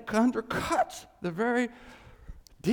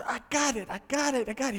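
quick higher-pitched repeated assurance; eager acted reassurance; 5 semitones higher, faster than usual. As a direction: jump in keenly, as if taking charge. Voices God eagerly taking charge.

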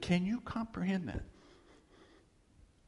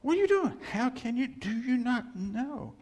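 much higher, incredulous cry of God; God aghast at his people; God's outraged voice; 8 semitones higher than usual. Retold or imitated. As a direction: rise up in disbelief as God confronting the sinner. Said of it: "Can you see God going".

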